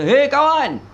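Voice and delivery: high, loud demonstration call; high intonation. Shown as a demonstration. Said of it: "Ada ketika intonasinya tinggi".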